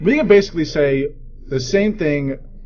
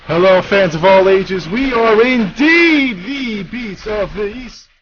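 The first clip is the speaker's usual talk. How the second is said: loud, higher radio intro with drawn-out words; show-opening announcer; 7 semitones higher, 4 dB louder, slower than usual. Acted. Host welcomes fans of all ages to the show, big announcer voice. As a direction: belt out the welcome, stretching the words.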